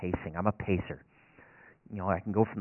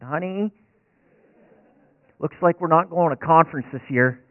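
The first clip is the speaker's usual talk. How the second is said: louder and higher, calling to the kitchen; calling out to wife; 6 semitones higher, 10 dB louder than usual. Retold or imitated. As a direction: call out to your wife in the next room, half joking.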